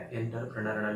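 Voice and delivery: एंटरप्रेन्योर starting "en-ter", low and understated; wrong pronunciation. Shown as a demonstration. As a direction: give the rejected "EN-ter" version. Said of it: "नहीं है". (एंटरप्रेन्योर का गलत उच्चारण, "एंटर" से शुरू)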